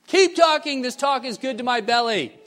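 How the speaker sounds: high, loud call from the back of the room; yelling encouragement. Retold or imitated. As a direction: yell it out to egg the speaker on. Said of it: "They'll yell from the back".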